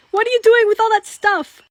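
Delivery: ordinary delivery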